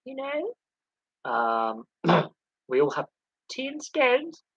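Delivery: a lightly put-on posh English accent, high and prim; teasing Americans with a mock posh English accent; mock Mary Poppins posh. To Americans, a mock posh English accent. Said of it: "they all think we talk like Mary Poppins".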